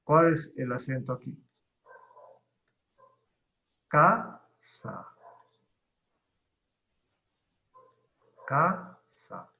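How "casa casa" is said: "Casa" with CA stretched and rising, sa dropped after a gap; exaggerated stress on CA. Shown as a demonstration. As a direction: overdo the first syllable of "Casa". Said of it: "ridiculizar la palabra para ubicar el acento". ("Casa" exagerando el acento en CA)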